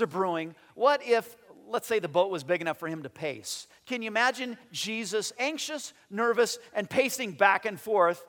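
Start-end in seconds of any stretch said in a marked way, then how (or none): none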